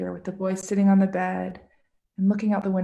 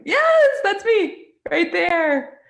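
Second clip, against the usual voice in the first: excited reveal; 11 semitones higher, 7 dB louder, much slower than usual. Real, not acted. Kids spot her in picture.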